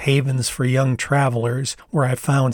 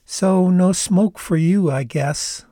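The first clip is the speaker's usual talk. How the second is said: higher, slow, playful Canadian voice; teasing Canadian accent; 6 semitones higher, slower than usual. Acted. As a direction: tease him gently, Canadian accent. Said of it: "she said, grinning".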